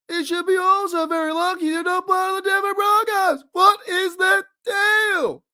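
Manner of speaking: very high, stretched stand-up whine; incredulous comic rant, pitch climbing; Jerry Seinfeld. Acted. Fan host riffing on revenge games as an exasperated high-pitched comedian. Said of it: "do my Jerry Seinfeld impression".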